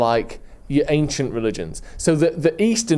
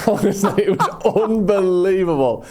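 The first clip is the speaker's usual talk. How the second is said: laughing; 6 semitones higher than usual. Real, not acted. Delighted food memory.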